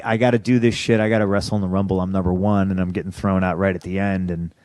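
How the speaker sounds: usual speaking voice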